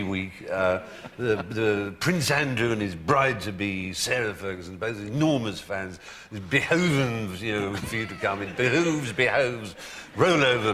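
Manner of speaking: slow, gushing invitation; David Frost. Retold or imitated. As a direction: coax effusively, dragging out the words. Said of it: "David Frost called him up and".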